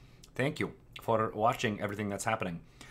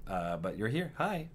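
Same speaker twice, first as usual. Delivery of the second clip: ordinary talk, nothing marked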